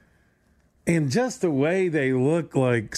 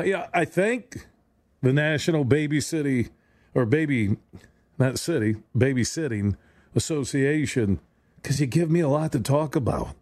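ordinary delivery

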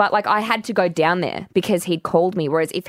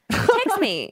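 high mock plea; 12 semitones higher than usual. Quoted wish, high pitch.

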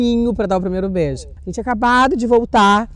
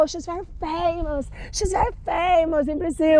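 gushing fan; 9 semitones higher, 3 dB quieter than usual. Retold or imitated. Fan explaining in English.

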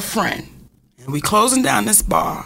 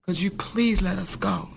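hushed, pleading whisper to the gunman; frightened whispered plea to a gunman at the door; quiet plea; 7 dB quieter than usual. Retold or imitated. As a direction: beg softly under your breath. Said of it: "And I said".

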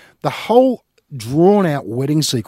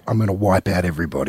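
quick low menacing mutter; low mafia threat; low fast gangster; 8 semitones lower, faster than usual. Retold or imitated. Quoting Michael eating orange.

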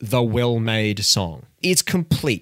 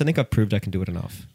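ordinary talk, nothing marked

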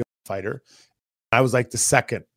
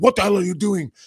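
higher-pitched mock scolding in an accent; Brash's accented scolding; 6 semitones higher, 4 dB louder than usual. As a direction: imitate an angry teammate's accented demand. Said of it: "he's got an accent".

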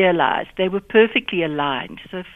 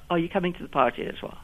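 fast, softer question, sinking in pitch and level to "as well"; falling inflection; 7 dB quieter, much faster than usual. Shown as a demonstration. Teacher showing South African phrase endings, falling inflection on "as well". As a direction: rattle off "are you coming to the party as well?", dropping off at the end. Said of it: "a falling inflection at the end of phrases".

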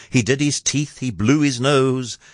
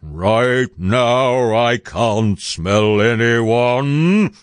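loudly muttering giant; 4 dB louder, much slower than usual. A narrator's reading. Giant sniffing while Jack hides beneath, loudly muttering giant. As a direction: rumble it out slowly as a huge giant. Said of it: "muttering loud".

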